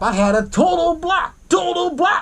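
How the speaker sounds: high-pitched mock cry, repeated; hammed-up outburst. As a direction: burst out theatrically on each phrase. Acting Otis's hammed-up outburst.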